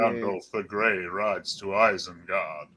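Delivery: slow, low wizard intoning; Christopher Lee. Acted. Friend doing his praised actor impression, slow and deep. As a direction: announce it gravely, low and drawn out. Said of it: "you did a great fucking Christopher Lee".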